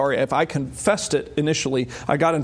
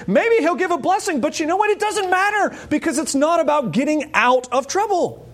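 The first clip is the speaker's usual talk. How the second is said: preaching peak; 11 semitones higher than usual. Real, not acted. Preaching climax.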